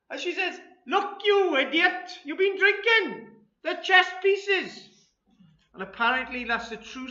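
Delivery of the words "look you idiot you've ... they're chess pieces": loud, high, slow Welsh scolding; Welsh accent. Acted. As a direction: spell it out as a fed-up Welsh wife. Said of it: "in a Welsh accent".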